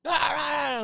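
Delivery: loud, high wordless hollering; mock yelling. Neighbour yelling at the door, mock holler. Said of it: "you hear him yelling at the door".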